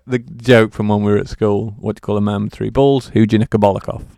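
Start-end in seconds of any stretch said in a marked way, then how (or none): none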